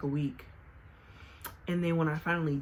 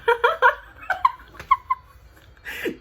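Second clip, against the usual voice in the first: a sudden high, loud laugh at herself; loud burst of laughter; 10 dB louder than usual. Real, not acted. Laughing at her own slip while asking viewers to subscribe.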